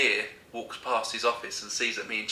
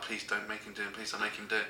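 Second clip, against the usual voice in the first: pleading under breath; 10 dB quieter, faster than usual. Retold or imitated. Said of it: "I'm like".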